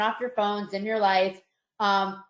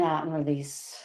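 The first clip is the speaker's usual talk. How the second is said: guided muscle relaxation. Acted. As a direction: talk them gently through tense and release. Leads progressive muscle relaxation.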